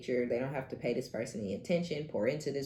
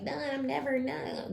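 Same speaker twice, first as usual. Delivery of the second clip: much higher, put-on whiny voice of a commenter; mocking commenter; 12 semitones higher than usual. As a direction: mimic the naysayer in a silly high voice. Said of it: "those in the comments who's like".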